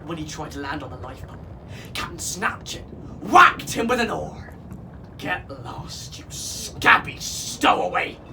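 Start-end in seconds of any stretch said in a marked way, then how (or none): none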